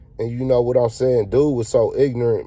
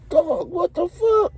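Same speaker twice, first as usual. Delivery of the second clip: high, drawn-out shocked cry; shocked outburst; slower than usual. Retold or imitated. Storyteller reacting to the dealer's strong weed, shocked high cry. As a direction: recoil from the stink, voice jumping way up.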